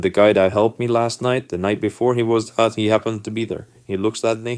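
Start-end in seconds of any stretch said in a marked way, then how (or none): none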